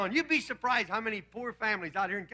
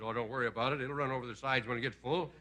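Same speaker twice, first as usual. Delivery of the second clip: lazy lounge-singer shrug in a lower voice; 8 semitones lower, 3 dB quieter than usual. Retold or imitated. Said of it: "Dean says".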